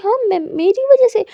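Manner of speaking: me? my fault? really?; a mock-offended question thrown back quickly; indignant disbelief. Aadiya accused, indignant.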